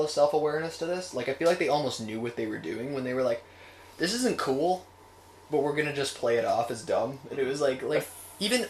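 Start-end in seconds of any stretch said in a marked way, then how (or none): none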